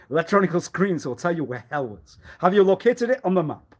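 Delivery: loud mocking rant. Mocking rant about finding hell.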